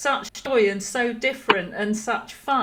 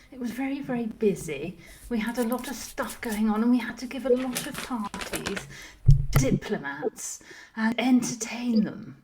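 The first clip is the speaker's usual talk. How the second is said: posh Laura. Acted. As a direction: murmur old political gossip like a grand lady. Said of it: "So Posh Laura".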